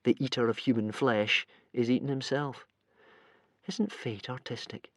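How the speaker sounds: quiet, far-off thinking voice; hushed private thought; inner thought. A narrator's reading. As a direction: muse it softly to himself. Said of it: "thought Hiccup".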